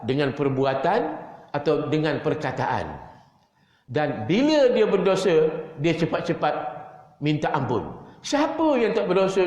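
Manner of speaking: ordinary talk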